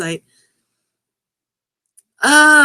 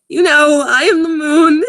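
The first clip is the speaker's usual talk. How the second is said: laughing while reading; 6 semitones higher, 7 dB louder than usual. Real, not acted. Reading absurd line, cracking up.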